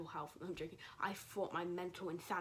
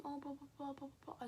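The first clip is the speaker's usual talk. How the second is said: higher-pitched mock teacher chatter; lightly mocking, raised-pitch blah-blah; mocking babble; 6 semitones higher, 4 dB quieter than usual. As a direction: imitate a teacher droning on in blahs.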